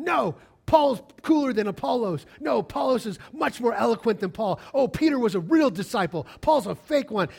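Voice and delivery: loud, high mock bickering; squabbling. Corinthian believers arguing over their favourite leaders, loud and squabbling. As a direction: argue it like rival fans.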